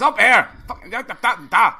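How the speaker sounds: high, loud Scottish burst in Connolly style; Billy Connolly Scottish accent. Retold or imitated. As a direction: belt it out Glasgow-style like Connolly. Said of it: "There's two Scottish accents".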